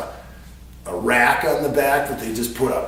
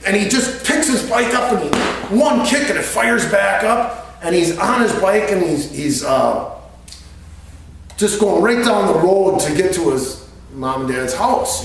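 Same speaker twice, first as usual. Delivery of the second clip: normal talking voice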